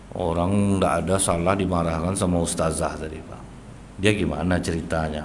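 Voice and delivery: low, subdued, even telling; calm, without tears. Acted. Child recounts being scolded by the teacher, calm and low. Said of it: "dia cerita dengan tenang, tanpa tangisan".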